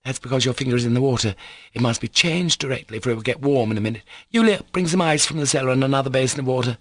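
put-on hurried, officious girl; bossy young girl. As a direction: take charge briskly. Lisa giving orders.

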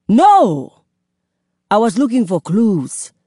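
loud, urgent back-and-forth; heated argument. A narrator's reading. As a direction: argue it fast and hot.

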